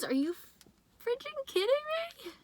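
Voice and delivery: very high, squealed disbelief; high astonished squeal; stunned disbelief. Real, not acted. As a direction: shriek in shocked delight. Shocked reaction to the cards.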